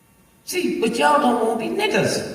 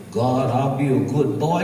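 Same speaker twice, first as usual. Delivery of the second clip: low, meek mock prayer; mocking a timid praying man; chump prayer; 11 semitones lower than usual. Mocking chump prayers to God in a meek voice. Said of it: "Y'all be saying chump prayers".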